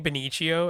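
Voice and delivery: Benicio said ben-EE-chee-oh; habitual mispronunciation. Real, not acted. Admitting a name slip to friends, Benicio as ben-EE-chee-oh. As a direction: say Benicio with a "ch" in the middle. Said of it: "I always say Benicio".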